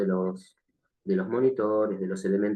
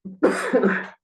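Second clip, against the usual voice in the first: real cough; 8 semitones higher, 4 dB louder than usual. Real, not acted. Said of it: "estoy con un poquito de tos".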